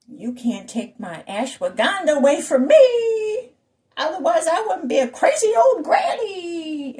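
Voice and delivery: hysterical mock wail. Someone protesting the loss of ashwagandha, hysterical mock wail. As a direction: cry it out, building to a shrill-pitched shout. Mimics hysterical people losing supplements.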